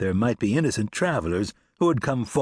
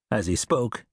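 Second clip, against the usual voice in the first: ordinary delivery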